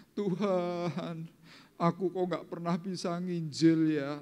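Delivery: high, drawn-out imitation of weeping; mock tearful prayer. Believer lamenting to God about not evangelizing, mock crying. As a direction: wail it slowly as a pretend lament. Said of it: "Coba saudara nangis".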